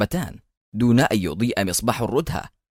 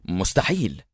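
low, firm and stern; stern refusal; 4 semitones lower, 4 dB quieter than usual. A narrator's reading. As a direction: refuse with quiet severity. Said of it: "ويقول في صرامة عجيبة". (صرامة هادئة بنبرة منخفضة)

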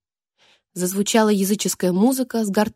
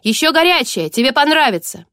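loud, high, gleeful yell; shouting clown; 4 semitones higher, 5 dB louder than usual. Acted. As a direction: shout it out with cruel glee. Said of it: "закричал".

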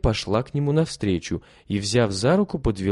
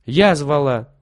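put-on louder, bold girl's cry; brave exclamation; 4 semitones higher, 5 dB louder, slower than usual. A narrator's reading. Lilya defends her guest to her brothers, bravely exclaiming. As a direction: stand up for him boldly. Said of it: "храбро воскликнула девочка".

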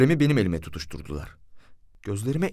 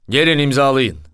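raised, firm official order; commissioner's order; 3 semitones higher, 7 dB louder than usual. A narrator's reading. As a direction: call out the order, louder than the narration. Commissioner orders the parties to sign.

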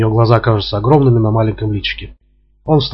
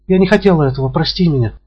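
lightly voiced, higher pleading apology; contrite woman; 6 semitones higher than usual. A narrator's reading. Woman apologizes after slap. (лёгкое высокое раскаяние)

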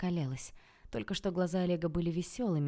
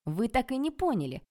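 higher, louder exasperated protest; irritated; 7 semitones higher, 5 dB louder than usual. A narrator's reading. Interrupted man trying to explain himself, irritated. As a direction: insist they have missed the point, annoyed. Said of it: "с раздражением в голосе сказал Анатолий".

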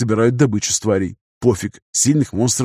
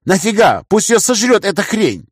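indignant; 9 semitones higher, 4 dB louder than usual. A narrator's reading. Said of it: "возмутился я".

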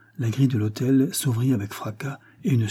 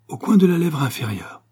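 higher, louder sudden recall; exclaiming; 6 semitones higher, 4 dB louder than usual. A narrator's reading. The count suddenly remembering the beauty mark, exclaiming. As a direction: burst out as it comes back to him. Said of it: "s'écria le comte".

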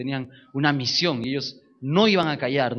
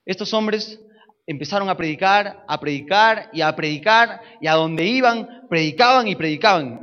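louder, building sermon delivery; preaching; 4 semitones higher, 6 dB louder than usual. Acted. Preacher telling the church how apostles preached, building. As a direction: drive the repetition home.

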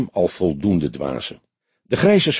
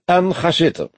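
high, affected invitation; put-on high voice, affected; affected commissaris; 8 semitones higher than usual. A narrator's reading. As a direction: offer a seat with affected airs. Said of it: "sprak hij geaffecteerd".

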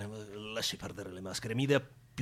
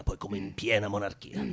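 usual speaking voice

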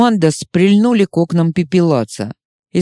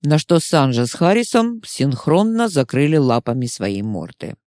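usual speaking voice

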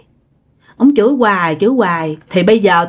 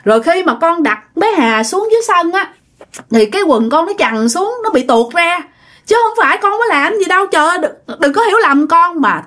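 higher-pitched, earnest self-defence; pleading explanation; 6 semitones higher than usual. Retold or imitated. Accused Quang explaining his wet trousers slipped, high acted plea. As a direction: act out a young man begging not to be misunderstood. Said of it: "anh Quang mới phân trần".

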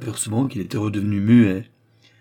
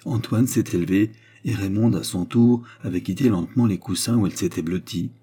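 ordinary delivery, nothing marked

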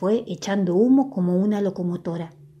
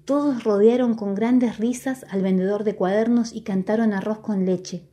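usual speaking voice

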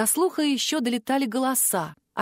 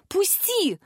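high, startled demand; high-pitched cry to be released; gasped cry; 8 semitones higher than usual. A narrator's reading. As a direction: gasp it out in protest. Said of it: "ахнула я".

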